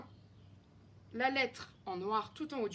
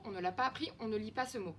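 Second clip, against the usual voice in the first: usual speaking voice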